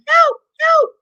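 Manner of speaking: high, loud call for help; retold yell for help. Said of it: "Bear is hearing somebody yelling".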